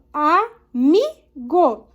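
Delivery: amigo very slow, in syllables, MI strongest; teacher sounding out "amigo", punching "mi"; stress on MI. Shown as a demonstration. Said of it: "Qual que eu falei mais, mais forte?".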